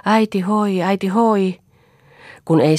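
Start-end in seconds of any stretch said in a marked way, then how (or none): 0.0-1.6 higher, loud calling from the boat. Acted. Children in a boat calling for their mother. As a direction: keep calling across the water. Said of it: "yhä vaan huudellen".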